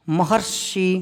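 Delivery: महर्षि said softly as ma-har-shi, half r joined; a quiet, careful महर्षि with the half r; correct pronunciation. Shown as a demonstration. Teacher reading out the correct form महर्षि, soft and slow. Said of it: "यूं लिखा जाता है".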